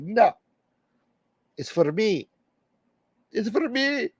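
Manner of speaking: put-on amateur Indian accent, pitched well up. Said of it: "a Indian accent".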